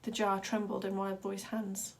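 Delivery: plain reading voice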